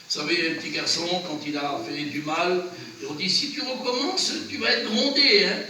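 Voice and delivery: usual speaking voice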